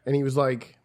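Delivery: ordinary talk, nothing marked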